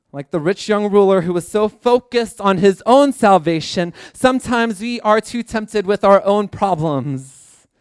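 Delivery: preaching. Acted. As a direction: preach it out to the congregation.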